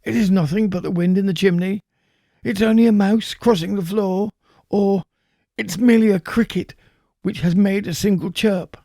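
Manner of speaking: put-on a frightened old man hurrying to calm himself; old man reassuring himself. A narrator's reading. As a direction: talk yourself out of the fear, quickly. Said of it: "He had been saying to himself".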